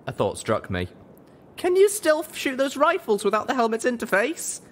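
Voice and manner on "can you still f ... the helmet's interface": high, anxious pony voice asking fast; high worried mare. Acted. Heroine anxiously asking Calamity about the rifles, high. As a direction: ask it pitched high with worry.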